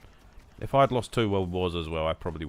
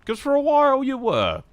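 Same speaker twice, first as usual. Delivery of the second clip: mock shout; 9 dB louder than usual. Joking about angry Germans.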